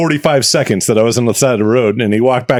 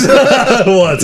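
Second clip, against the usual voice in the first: higher and louder, laughing as you admit it; 11 semitones higher, 4 dB louder than usual. Real, not acted. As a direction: giggle the confession. Laughing admission of being caught.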